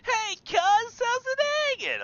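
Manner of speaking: very high, loud squealed hello; over-eager greeting. Acted. Cousin greeting played up for a joke, high loud squeal. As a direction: shriek it slowly, absurdly high.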